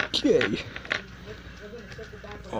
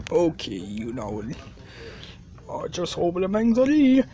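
normal talking voice

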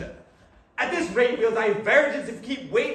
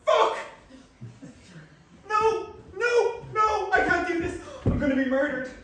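high, loud frantic cries; panicked; 11 semitones higher, 4 dB louder than usual. Acted. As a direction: lose your nerve in a rush of panic. Panics at the stranger's door.

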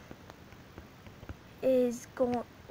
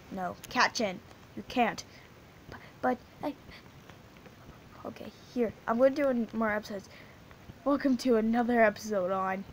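ordinary talk, nothing marked

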